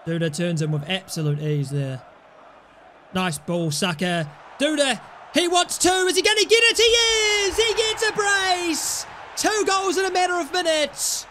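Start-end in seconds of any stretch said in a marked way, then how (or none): 4.6-11.3 excited commentary. Real, not acted. Gamer calling his own brace, high excited commentary. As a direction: build to the brace. Celebrating a second goal.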